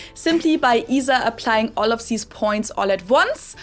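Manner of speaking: heavy German accent, th turned to s or z; thickly German-accented English; strong German accent. Shown as a demonstration. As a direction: lay on every German accent feature at once. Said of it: "different degrees of a German accent".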